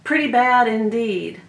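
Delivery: high mock disgust, then trailing off. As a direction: act out a horrified reaction. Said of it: "I thought".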